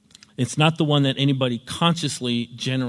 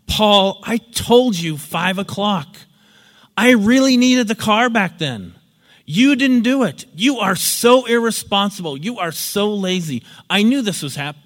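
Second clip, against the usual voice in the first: angry scolding; 6 semitones higher, 7 dB louder than usual. Retold or imitated. Lender berating his friend for the late car, angry. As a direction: lay into him, furious and blaming. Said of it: "he starts in on Paul".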